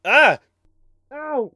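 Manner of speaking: high, loud yelps of pain and fright. Acted. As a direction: yelp as if being stabbed. Being stabbed by the bunny.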